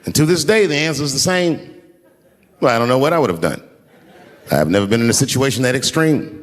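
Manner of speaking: ordinary delivery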